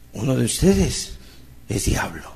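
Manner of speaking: quiet, grave words of Jesus; solemn Jesus quote. Retold or imitated. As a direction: drop the voice for a solemn warning.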